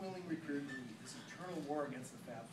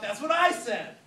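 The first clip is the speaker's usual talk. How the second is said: loud, higher yell; 9 semitones higher, 16 dB louder, slower than usual. Acted. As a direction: shout it across the bar. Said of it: "I yelled out".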